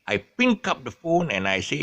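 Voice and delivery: ordinary delivery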